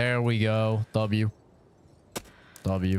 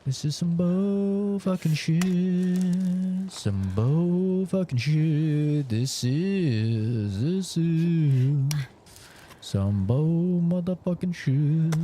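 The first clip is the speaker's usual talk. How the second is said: slow, stretched disgust; drawn-out exasperation; 6 semitones higher, much slower than usual. Real, not acted. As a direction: drag every word out in disbelief. Frustrated rant at the game.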